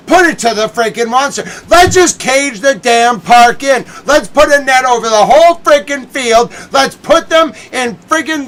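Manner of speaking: loud, high ranting yell; angry yelling. Real, not acted. Red Sox fan yelling to George about the net, furious. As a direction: unload years of anger at the top of the voice. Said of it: "I've never been able to yell about that net".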